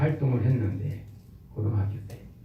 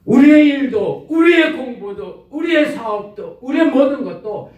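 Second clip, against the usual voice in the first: loud, higher rallying sermon voice; full-force preaching; 6 semitones higher, 7 dB louder than usual. Acted. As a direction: build up the congregation with conviction.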